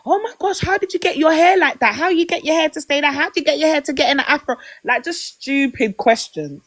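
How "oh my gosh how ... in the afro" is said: high, hurried mock amazement; gushing co-worker imitation. Co-worker quizzing her about her afro, mock gushing. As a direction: fire off questions like a fascinated colleague, pitched up.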